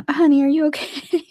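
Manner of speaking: high-pitched mock concern; lightly acted worried check-in; concerned partner. Speaker checking on upset partner, high mock concern. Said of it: "And I'm like".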